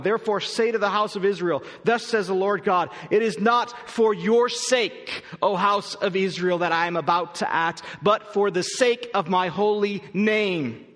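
slow, weighty reading of the verse; scripture reading. Acted. Pastor reading the verse to the church, slow and weighty. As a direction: lean on each word of scripture.

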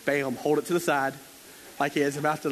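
usual speaking voice

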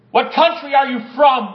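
loud, demanding shout; shouted question. Acted. Sailors shouting at Jonah during the storm, loud demand. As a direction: bark the question over the storm.

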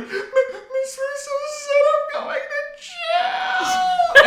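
very high, drawn-out mock wail; hysterical crying. Child telling the teacher, hammed-up hysterical wailing. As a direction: imitate a child bawling and gasping for air. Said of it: "hysterically crying".

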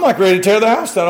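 ordinary delivery

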